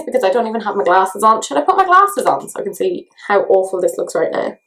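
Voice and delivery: normal talking voice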